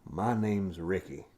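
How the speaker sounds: slow Southern drawl; hammed-up, drawn-out Southern twang; Southern accent. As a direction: introduce yourself as a slow-talking Southerner. Playing country characters in accent.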